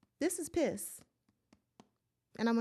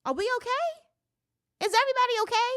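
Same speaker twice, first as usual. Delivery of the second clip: fake-sweet concern for everyone; mock concern; 11 semitones higher than usual. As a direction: ask in a put-on high worried voice.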